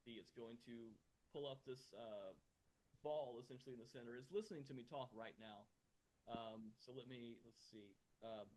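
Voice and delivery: muffled and distant, as if through a wall. Real, not acted. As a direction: voice muffled and far off. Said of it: "now it sounds like you're very muffled".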